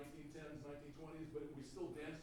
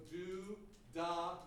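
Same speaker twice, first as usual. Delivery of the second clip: "da" blown out louder with lots of air; accented da; 6 dB louder than usual. Shown as a demonstration. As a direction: push a volume of air into "da". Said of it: "amount of volume of air".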